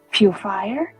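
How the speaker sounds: normal talking voice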